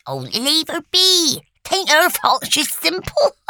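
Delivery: high, slow, drawn-out kindly voice; kindly resident. A narrator's reading. Resident defends Lucy to the others, kindly and high-pitched. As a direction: stick up for her in a high put-on voice. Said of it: "said another voice kindly".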